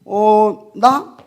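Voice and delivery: high, loud, sarcastic comeback; mock snappy retort. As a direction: toss it back with a laughing jab. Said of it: "내가 또 성질 나 있기 때문에". (비꼬듯 맞받아치며)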